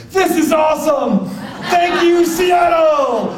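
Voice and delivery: high, loud mock victory yell; hammed-up, exultant acceptance shout; triumphant award shout. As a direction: holler to the whole crowd like a rock star. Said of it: "pulls it up and he goes".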